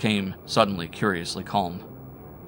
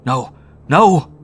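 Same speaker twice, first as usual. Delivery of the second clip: loud high shriek; terrified shriek of denial; 7 semitones higher, 14 dB louder than usual. A narrator's reading. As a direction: shriek the refusal. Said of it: "I shrieked".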